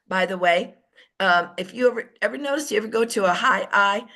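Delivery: ordinary talk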